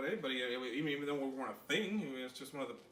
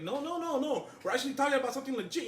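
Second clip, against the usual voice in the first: high defensive denial; 10 semitones higher, 6 dB louder than usual. Retold or imitated.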